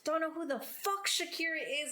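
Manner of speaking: high, hammed-up indignation with a laugh in it; mock-outraged inner tirade; exasperated rant. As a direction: groan and drag out the insults. Venting her childhood thoughts aloud.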